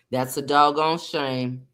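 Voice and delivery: low, slow daddy voice. Acted. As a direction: lightly put on dad's low, weary-paced verdict. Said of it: "In my daddy voice".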